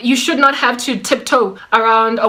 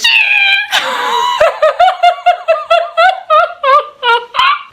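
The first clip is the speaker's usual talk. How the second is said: high, loud peals of laughter; long shrieking real laugh; helpless laughter; 4 dB louder than usual. Real, not acted. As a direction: crack up completely at your own story. Laughing at her own story.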